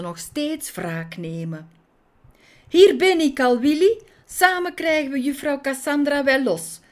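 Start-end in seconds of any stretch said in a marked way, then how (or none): 2.7-6.8 louder, higher eager little bee; calling out. A narrator's reading. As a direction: call out to her friend as help arrives. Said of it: "riep het bijtje".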